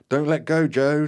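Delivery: high, very loud urgent shout; roared command. A narrator's reading. Terry urges Joe to keep hold, roaring. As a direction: roar it back urgently. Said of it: "Terry roared back at me".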